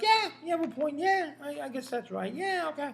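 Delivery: high, easygoing agreeing; Bill Burr impression. Bill Burr agreeing with the interviewer, amused. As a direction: roll with it, amused. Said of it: "he'll be like".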